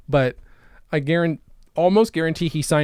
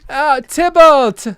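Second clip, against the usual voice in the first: high, slow and loud cry of Tybalt; hammed-up Pinocchio; 11 semitones higher, 9 dB louder, much slower than usual. Calling for the movie cat, high and slow. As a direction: wail the name like a cartoon boy.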